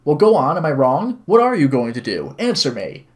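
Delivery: loud, demanding acted questions; furious. Woman demands answer from visitor, furious. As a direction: press hard for an answer. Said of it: "she belted out the words furiously".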